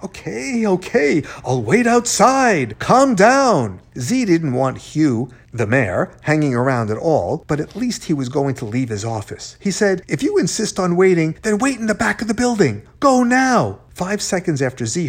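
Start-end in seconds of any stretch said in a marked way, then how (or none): 0.0-3.8 high-pitched, agitated placating; exasperated giving in. A narrator's reading. Mayor giving in to yelling. 10.1-13.8 high, insistent command rising to the end; urgent ordering. A narrator's reading. Frantically sending the mayor away.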